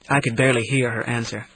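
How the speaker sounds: plain reading voice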